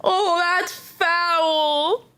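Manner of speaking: slow disbelief. Real, not acted. Streamer reacts in disbelief.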